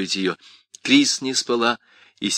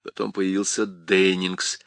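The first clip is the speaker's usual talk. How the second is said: plain reading voice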